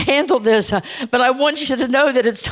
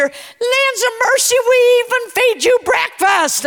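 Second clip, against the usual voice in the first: excited exclamation; 12 semitones higher than usual. Real, not acted. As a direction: voice jumps high with delight. Preacher exclaims about church perks.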